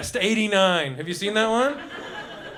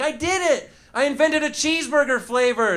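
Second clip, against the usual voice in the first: higher-pitched mock eureka cry; triumphant scientist; 7 semitones higher than usual. Lab scientist announcing a new flavor, mock triumphant. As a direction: announce your invention with comic pride. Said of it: "maybe one scientist".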